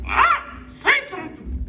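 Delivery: very high squawky parrot voice; squawk, then parrot it back in a high puppet voice; talking parrot. Acted. Parrot puppet echoing the ventriloquist's order to talk, squawky high voice.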